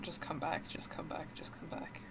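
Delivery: soft echo fading into whisper. Retold or imitated. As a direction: let each repeat fade until whispered. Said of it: "you're just stuck on".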